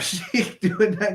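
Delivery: normal talking voice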